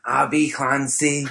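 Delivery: slow Scouse name drop; drawn-out Scouse Abby Clancy; Liverpool accent. Retold or imitated. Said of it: "Is that your Liverpool accent?".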